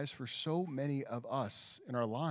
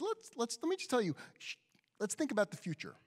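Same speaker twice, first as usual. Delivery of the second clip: much higher, bright put-on voice; high mock voice; 10 semitones higher, faster than usual. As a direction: lift the voice way up, playful. Imagined proposal, much higher voice.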